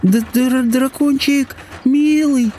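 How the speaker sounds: very high, quavering princess stammer; frightened high-pitched coaxing, stuttered; trembling voice. A narrator's reading. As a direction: stammer the first sound, voice trembling. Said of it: "повторила дрожащим голосом".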